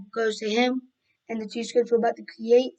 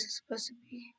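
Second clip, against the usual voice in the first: whisper; 16 dB quieter than usual. Real, not acted. Muttering to self while building.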